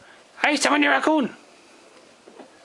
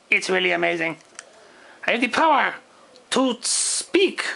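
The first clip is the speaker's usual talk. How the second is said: ordinary talk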